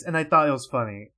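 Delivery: plain reading voice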